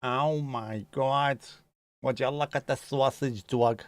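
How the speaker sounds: very slow, drawn-out New York vowels; New York accent. Pretend. Streamer marvelling at a sausage dog, mock New York accent. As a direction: gush in a put-on Brooklyn accent. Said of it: "filthy Scouse New York accent".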